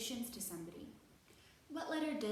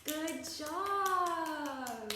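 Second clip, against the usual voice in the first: bright praise; 6 semitones higher, 7 dB louder than usual. Real, not acted. Praise after the song.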